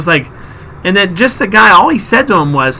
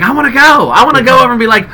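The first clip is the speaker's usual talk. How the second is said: eager; 7 semitones higher, 3 dB louder than usual. Retold or imitated. Eager urge to ask the lady.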